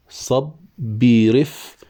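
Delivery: very slow, drawn-out syllables; syllable scanning. Shown as a demonstration. Teacher scanning verse for students, very slow. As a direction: spell out the meter beat by beat. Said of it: "فاعل".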